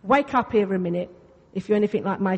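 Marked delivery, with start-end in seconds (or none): none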